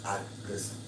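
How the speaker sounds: soft, low, tender apology; soft tender plea. Shown as a demonstration. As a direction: murmur it softly, calming him down. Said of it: "kiss him on his cheek and say".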